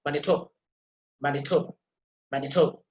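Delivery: Manitoba with stressed TO and nearly silent last vowel; unstressed final schwa. Shown as a demonstration. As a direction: let the end of Manitoba almost vanish after TO. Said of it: "last schwa vowel that is almost inaudible".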